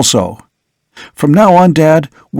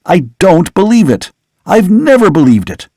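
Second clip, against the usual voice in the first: higher, urgent denial; vehement protest; 5 semitones higher than usual. Acted. Son jumping up to deny his father is a vampire, higher and urgent. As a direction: push back hard, pitch up.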